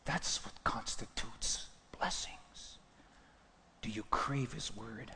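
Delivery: slow hushed whisper of awe. Real, not acted. Pastor to congregation, a reverent slow whisper. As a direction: lean in and breathe it.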